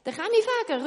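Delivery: high, loud call of a name. Acted. Baker calling for the chimney sweep, loud call. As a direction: call him over. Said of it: "ruft der Beck".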